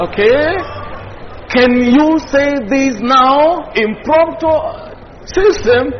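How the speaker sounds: usual speaking voice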